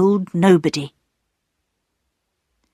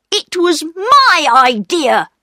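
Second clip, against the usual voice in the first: put-on high, slower indignant boy voice; angry protest; 10 semitones higher, 4 dB louder than usual. A narrator's reading. Peter claiming the blame from his sisters, angry and high. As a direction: insist on it, pitched high and drawn out. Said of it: "said Peter angrily".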